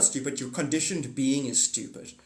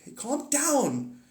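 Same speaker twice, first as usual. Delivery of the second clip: calming; 10 semitones higher, much slower than usual. Retold or imitated. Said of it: "I'm like".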